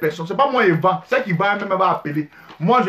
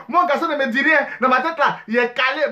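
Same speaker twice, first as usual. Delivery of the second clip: ordinary delivery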